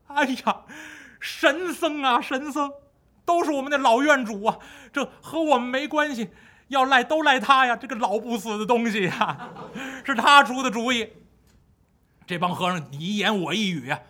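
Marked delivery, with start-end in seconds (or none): 0.1-11.1 higher, fawning and pleading; groveling monks. Acted. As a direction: beg and shift the blame. Monks begging, blaming abbot. (谄媚讨饶的和尚)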